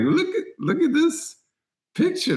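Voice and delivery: high-pitched, laughing through the words; cracking up while pointing at the photo. Retold or imitated. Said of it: "I hit the floor laughing".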